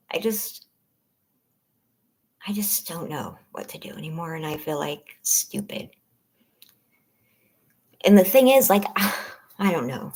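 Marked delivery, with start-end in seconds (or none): 0.1-5.9 soft unvoiced whisper, barely there. Real, not acted. Streamer confiding her fear to members, whispering. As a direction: hush it down as if afraid to say it.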